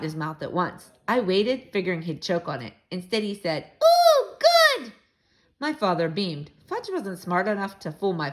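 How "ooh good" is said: put-on high, loud little-kid squeal of delight; toddler delight. Toddler Fudge loving his brother's omelet, delighted squeal. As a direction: gush it like a thrilled toddler.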